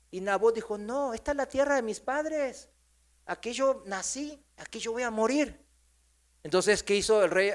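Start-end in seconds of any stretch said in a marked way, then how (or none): 0.8-5.6 higher-pitched, lightly voiced stubborn landowner; mild firm refusal. Nabot turns down the king's offer for his fathers' land, mildly defiant.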